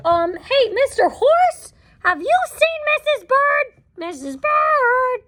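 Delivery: high, sing-song call to the horse. Acted. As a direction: lift the voice right up to hail a friend. Calling to Mister Horse.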